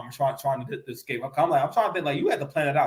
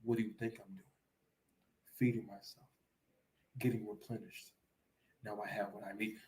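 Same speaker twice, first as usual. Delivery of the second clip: low, much softer, unhurried reply; calm sly King Bao; 6 semitones lower, 17 dB quieter than usual. Retold or imitated. Caught in the kitchen, King Bao answers the homeowner low and soft. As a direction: answer like a cool thief with his mouth full of wings. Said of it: "and King Bao was like".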